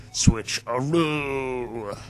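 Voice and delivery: drawn-out magic word. Acted. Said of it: "Watch this".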